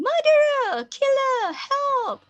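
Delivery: high-pitched cry of alarm; screaming. Acted. Woman finding a dead man, screaming. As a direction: scream out in horror for help. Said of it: "And began screaming".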